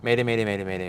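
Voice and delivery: fly through every "Mayday" without a pause; rushed-through Mayday. Shown as a demonstration. Showing the co-host how a reader might race through it, rushed. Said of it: "and just you fly through it".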